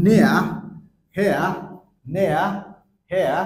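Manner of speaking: নেয়া, হেয়া said loud, e sliding into a, falling; loud নেয়া, হেয়া with a falling glide; loud example words. Shown as a demonstration. Teacher drilling example words, loud and clear. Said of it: "যেমন আমরা বলবো".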